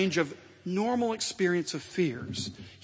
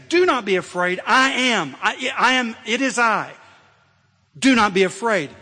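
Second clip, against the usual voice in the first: fervent proclamation; 4 semitones higher, 6 dB louder than usual. Acted. Preacher voicing Jesus, rising fervour.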